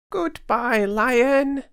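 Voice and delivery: very high squeaky little mouse voice; a tiny mouse piping up to reassure. Acted. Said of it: "said Mouse".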